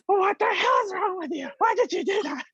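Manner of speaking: high, sharp mock scolding; angry wife. Wife scolding husband for the coffee, high mock anger. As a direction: snap at the husband over spilled coffee. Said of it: "She's like".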